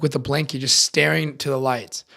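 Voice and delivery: usual speaking voice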